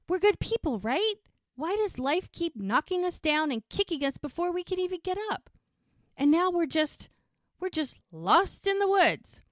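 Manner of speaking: high-pitched, loud despairing rant; acted high-pitched exasperation; imitated frustration. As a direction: lament it as if at wit's end. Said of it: "She's frustrated".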